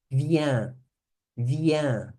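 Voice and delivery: Viens said vyan, nasal end, no final S sounded; Viens, silent S, slow and careful; pron viens. Shown as a demonstration. Teacher modelling a silent S for students: Viens said vyan. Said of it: "Não falo o S final".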